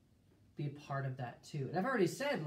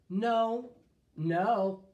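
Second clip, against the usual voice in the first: emphatic God; 5 semitones higher, 9 dB louder than usual. Acted. God voiced in scripture reading.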